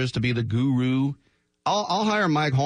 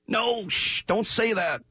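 much higher imitation of frantic hushing; mock panicked shushing; 10 semitones higher, slower than usual. Team staff silencing the boss, mock panicked shushing. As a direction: cut him off with a high hush. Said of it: "I'm sure everybody was like".

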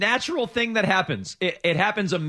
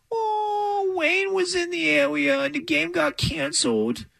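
high, slow crybaby whine; whiny baby voice; 12 semitones higher, slower than usual. Retold or imitated. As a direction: sulk it like a spoiled kid. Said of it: "that's how they sound".

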